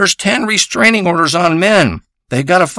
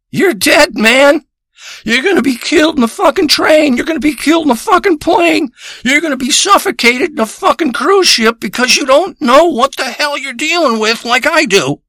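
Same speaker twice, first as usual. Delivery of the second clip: high, urgent ranting; alarmed warning; 8 semitones higher than usual. Acted. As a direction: warn him as if doom is near, pitched way up. Frantic warning to the listener.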